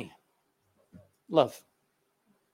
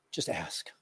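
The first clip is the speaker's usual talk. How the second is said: much lower, softer whisper; 11 semitones lower, 12 dB quieter than usual. Acted. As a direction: lean in and whisper the answer. Pastor's hushed answer.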